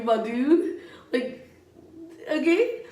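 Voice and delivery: chuckling through the words, rising at the end; laughing. Real, not acted. Teasing the person off camera, laughing. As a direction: giggle it out, still amused.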